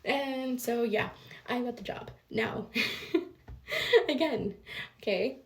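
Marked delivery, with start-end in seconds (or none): 2.7-4.0 laughing. Real, not acted. Laughs at herself.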